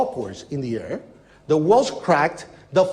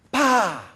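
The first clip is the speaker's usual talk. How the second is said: short, higher pop with falling pitch; imitated small explosion; 5 semitones higher than usual. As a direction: mimic another small blast going off. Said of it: "we hear small explosions going off".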